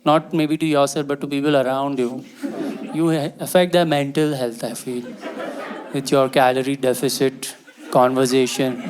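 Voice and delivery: ordinary delivery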